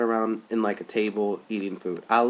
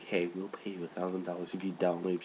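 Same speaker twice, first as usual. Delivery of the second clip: much softer, low aside; muttered offer; 5 semitones lower, 12 dB quieter than usual. Retold or imitated. Producers quietly bribing Snooki, soft and low. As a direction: murmur the offer under your breath. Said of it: "it's like".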